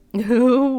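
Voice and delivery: high, loud hooting laugh. Real, not acted. Player reacting to the game, loud laugh.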